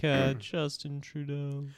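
a hint of a slow dumb American; mock American. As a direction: drawl it like a clueless American. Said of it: "all the Americans like".

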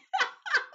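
loud, high burst of laughter; sudden helpless high cackle; bursting out laughing. Real, not acted. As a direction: crack up at your own slip. Cracks up at own pronunciation.

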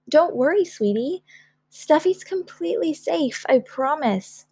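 high, lilting comfort; reassuring mother. Acted. Mother promising the toy is safe, high and reassuring. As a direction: soothe a worried little one. Mother soothes worried child.

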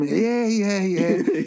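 high, dismissive yeahs; drugged brush-off. Retold or imitated. Patient on morphine brushing off the nurse, high and dismissive. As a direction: wave the nurse off, high and dozy.

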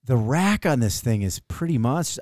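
ordinary delivery

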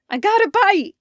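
high, loud excited yell. Acted. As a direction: shout it out, thrilled by the catch. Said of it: "he yelled".

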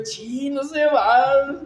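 drawn-out, dismayed chi no se va; slow dismayed mutter. Retold or imitated. Missionary to himself at the clogged toilet, slow and dismayed. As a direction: stretch it out like a stuck man staring at the toilet.

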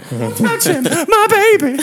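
loud, high mock scream; hysterical girlfriend imitation. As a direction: shriek protectively as the girlfriend. Voicing the hysterical girl.

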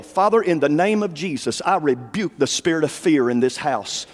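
low, firm prayer of rebuke against fear; rebuking prayer. Real, not acted. Preacher rebukes fear over the house in prayer, low and firm. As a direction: pray with authority, rebuking the spirit.

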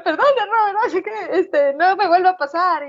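mock nervous apology.

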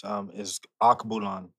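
Akbulan said with an African lilt; African accent. Real, not acted. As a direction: native African pronunciation of Akbulan. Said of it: "you sound all African when you said it".

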